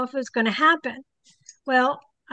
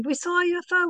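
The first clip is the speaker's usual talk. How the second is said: higher, breathless announcement; excited report; 6 semitones higher, faster than usual. Retold or imitated. Group runs back from woods, excited. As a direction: burst in with the news.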